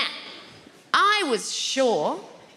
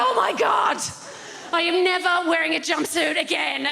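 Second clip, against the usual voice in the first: exasperated outburst; 7 semitones higher than usual. Retold or imitated. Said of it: "I sat back down and went".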